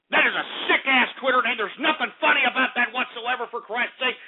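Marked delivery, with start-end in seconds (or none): none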